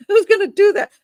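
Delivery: high, loud disbelieving outburst; incredulous. Real, not acted. Host scoffing at an unlikely murder-for-hire story, incredulous. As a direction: exclaim it as if it is absurd.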